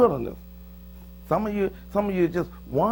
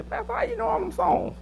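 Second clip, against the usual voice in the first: imitated questioner; 5 dB quieter than usual. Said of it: "I'm gonna say".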